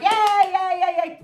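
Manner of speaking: high, loud brush-off yeahs; dismissive yeahs. Retold or imitated. Brushing off her husband's praise, high and dismissive. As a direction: wave him off with yeahs. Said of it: "but I'm like".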